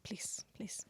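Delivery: unvoiced, hushed urging; whispered please. Professor whispers to the girl to speak. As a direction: breathe it almost silently. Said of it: "he's like".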